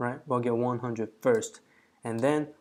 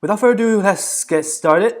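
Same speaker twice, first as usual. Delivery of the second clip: loud and higher, hyped kickoff; excited; 8 semitones higher, 12 dB louder than usual. Real, not acted. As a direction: announce the start with big energy. Kicking off the coding timer.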